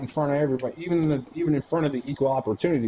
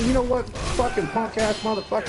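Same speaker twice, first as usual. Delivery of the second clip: much higher, heated challenge; escalating yell; 12 semitones higher than usual. Retold or imitated. Soldier challenging a sergeant to fight, yelling high. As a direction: ramp up into a challenge.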